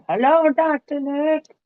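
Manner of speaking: playful squeaky greeting; high put-on voice. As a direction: chirp it like a cartoon doctor. Echoing Simpsons greeting.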